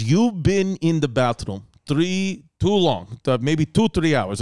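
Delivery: mock Russian mover, slow. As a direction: drag it out like a know-it-all Russian mover. Mover diagnosing him.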